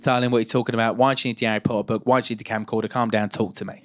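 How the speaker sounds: low-pitched impression of an Essex mother; calm an excited child, voice dropped low; imitating a low-voiced Essex mum. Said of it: "This is what she sounded like".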